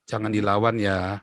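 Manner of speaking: low, slow, gentle fatherly caution; father's calm advice. Retold or imitated. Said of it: "ayah saya selalu bilang apa".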